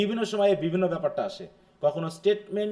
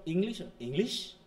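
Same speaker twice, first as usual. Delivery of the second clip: slow English with a rising end; questioning tone; 5 dB quieter, slower than usual. Shown as a demonstration. Teacher showing how tone asks, rising tune on English. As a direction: lift the end of English into a query. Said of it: "টোনটা আমাকে বলে দিচ্ছে".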